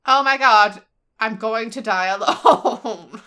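loud, drawn-out mock despair; hammed-up wail. May despairing at the mirror, hammed-up loud wail. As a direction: wail it slowly, breaking toward a laugh.

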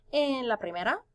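ordinary talk

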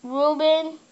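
yelling up the stairs; calling out. Real, not acted. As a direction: call out loudly to grandpa. Yelling to grandpa downstairs.

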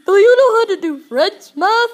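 very loud, high put-on teacher voice; hammed-up high teacher yell; mock teacher shout. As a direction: holler the question as the awful teacher. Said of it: "and then she's like".

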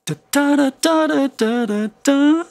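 high, loud da-DA rhythm, second beat punched; stressed da da syllables. Shown as a demonstration. Teacher showing students the da-DA stress pattern, high and loud. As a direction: sing out da da with the accent landing on the second da. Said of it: "we'll stress the second syllable of a word".

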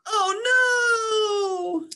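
high, loud cry of alarm; panicked cry. Acted. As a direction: scream as you fall down the drain. Raindrop swept into the storm drain.

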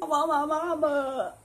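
a hint of whimper, a small voice; whiny drunk. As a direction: start whining for mama. Drunk, starts to cry.

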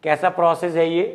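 normal talking voice

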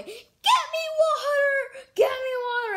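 very high, loud, drawn-out scream. Retold or imitated. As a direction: scream desperately for water down the hallway. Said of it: "screaming in the hallway".

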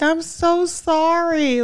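high, slow teary apology; crying kid. Retold or imitated. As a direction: mimic a sobbing little boy. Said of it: "he was like".

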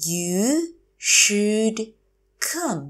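You, should, come, each separate; slow teacher model of "You should come"; word by word. Shown as a demonstration. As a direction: pause between the words of "You should come". Teacher repeats phrase word by word.

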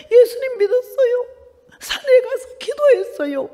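telling the nun through tears; tearful answer. Retold or imitated. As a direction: let the tears break through.

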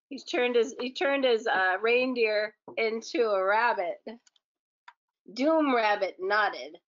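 usual speaking voice

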